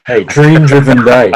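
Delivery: usual speaking voice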